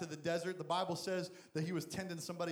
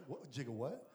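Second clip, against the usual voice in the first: lower, softer stunned "jigga what"; mock-baffled Mary; 6 semitones lower, 5 dB quieter than usual. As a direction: react dumbfounded, half laughing. Mary's baffled reaction.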